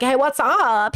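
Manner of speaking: camp greeting with a long falling UP; put-on camp drawl. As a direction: playfully imitate his camp hello.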